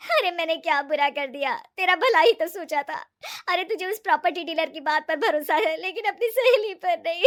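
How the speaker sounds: hammed-up wailing, high and loud; loud fake crying. Ritu caught out, wailing to her friend, loudly crying. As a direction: protest innocence through exaggerated sobs. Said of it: "जोर जोर से रोने लगती है".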